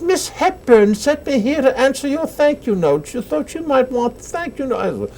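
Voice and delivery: British lady's voice. Retold or imitated. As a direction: play the tiny British lady in a high, slow British accent. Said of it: "very British".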